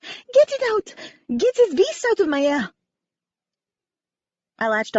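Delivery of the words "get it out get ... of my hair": put-on high, loud French-accented shriek; frantic French woman crying out; panicked scream. A narrator's reading. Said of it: "The slender woman trembled".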